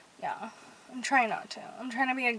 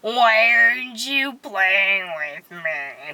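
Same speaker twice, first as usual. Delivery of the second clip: lower, slow pet voice, swelling louder at the end; lightly voiced cat Lola pleading; 4 semitones lower, 5 dB louder than usual. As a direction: voice the cat's complaint. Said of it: "That's my Lola voice".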